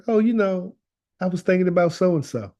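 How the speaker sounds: high, offhand mock musing; casual opener. Bringing up a relative to start the talk, casually. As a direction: lightly act out bringing it up casually.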